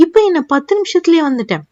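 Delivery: ordinary delivery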